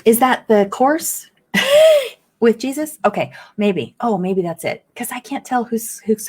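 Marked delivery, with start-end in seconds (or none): 1.5-2.1 a short high laugh bursting out; quick high giggle; burst of laughter. Real, not acted. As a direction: laugh it off mid-question. Laughs mid-question about Jesus course.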